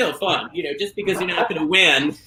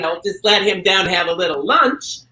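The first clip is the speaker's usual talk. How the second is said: ordinary talk, nothing marked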